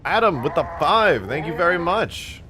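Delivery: bright, loud, high thank-you; loud, high and thrilled shout-out to a donor; excited thanks. Real, not acted. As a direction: thank the donor with a burst of energy.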